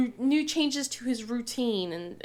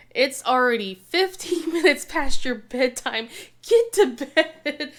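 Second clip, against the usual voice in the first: louder, firm bedtime order; firm mom; 3 semitones higher, 6 dB louder than usual. Retold or imitated. Mom telling her daughter past bedtime to go to bed, firm. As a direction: lay down the law, half laughing. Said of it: "I'm going".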